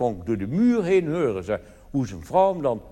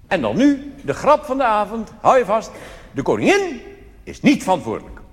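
louder, higher build-up; mock announcement; 6 semitones higher, 5 dB louder than usual. Comedian hypes the joke of the evening, mock fanfare. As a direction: announce it like a showman.

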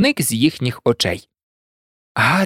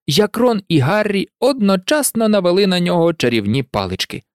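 plain reading voice

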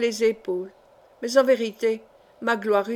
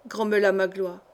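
ordinary delivery, nothing marked

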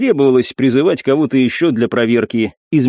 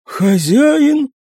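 high servant voice; 13 semitones higher than usual. Acted. Servant answers his master.

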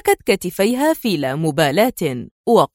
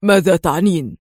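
irritated; 3 semitones lower than usual. A narrator's reading. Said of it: "يسألها في حنق".

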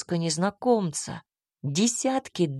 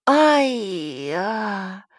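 sharp cry of a sudden fall; tripping and falling. A narrator's reading. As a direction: yelp as you hit the floor. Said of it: "растянулся на полу".

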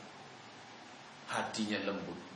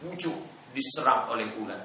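usual speaking voice

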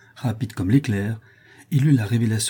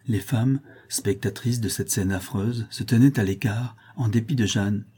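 ordinary delivery

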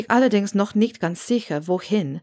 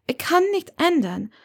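put-on higher, lively young woman's voice; young witch Hermione, defiant; 8 semitones higher than usual. A narrator's reading. Hermione defends her life to Severus, higher and lively. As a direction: speak up brightly for her as she defends her choice.

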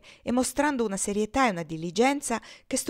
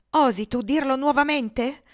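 loud, high outraged challenge; scandalized scolding; indignant; 6 semitones higher, 8 dB louder than usual. Acted. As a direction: demand it in raised-voice indignation. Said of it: "restò così indignata di quell'impudente menzogna".

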